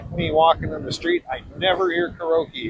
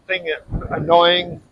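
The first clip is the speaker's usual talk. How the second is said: usual speaking voice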